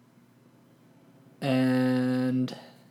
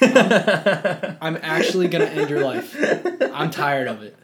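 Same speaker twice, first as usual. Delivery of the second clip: laughing mock threat; 3 semitones higher, 6 dB louder than usual. Mock threat after friend's teasing.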